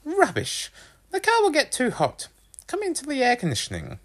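very high, brisk mother voice; dismissive mum. A narrator's reading. Mum dismisses son's excuse.

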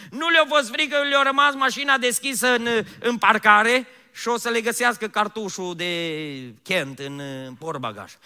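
high, loud preacher's cry; full-force preaching. Acted. Preacher mocking the apostles' worries, loud preaching cry. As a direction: belt it out over the congregation.

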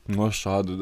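low, mock-disappointed reply; passive-aggressive. Friend quoting a reply he took badly, passive-aggressive. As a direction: let the disappointment drop low and pointed. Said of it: "das war passiv-aggressiv gemeint".

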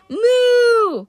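high, loud bellowing moo; Grover's loud cow call. Acted. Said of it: "Grover translated".

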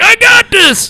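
high, loud stoned bravado; stoned kid bragging from the dryer; cocky stoner. Retold or imitated. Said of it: "He's like".

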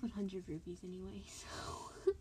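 hushed giggly whisper. Real, not acted. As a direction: drop to a whisper. Aside to sister, giggling.

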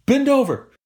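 very high, squeaky put-on order; mock instructor. Joking about the trainer's order, very high squeaky voice.

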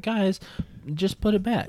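high, lightly voiced plea; pleading kid sister. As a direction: beg them in a high voice. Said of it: "You're like".

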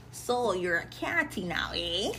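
slow, low teasing drawl; quiet, slow needling of a sister; low mock-knowing taunt; teasing brother. Brother teasing about her guy.

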